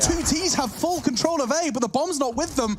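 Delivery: excited high caster call; rising play-by-play hype; esports caster. Real, not acted.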